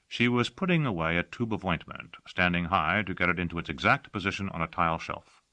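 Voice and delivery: usual speaking voice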